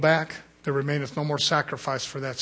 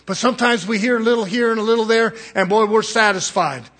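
higher and louder, preaching it out; building sermon; 7 semitones higher, 8 dB louder than usual. Acted. Preacher rebuking his congregation in rising preaching voice. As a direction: lift into a revival preaching cadence. Preacher warms to full force.

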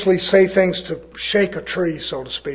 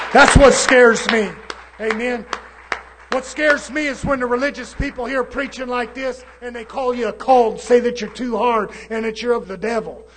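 fiery preaching; 4 semitones higher than usual. Acted. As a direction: thunder it out from the pulpit. Preaching at full force.

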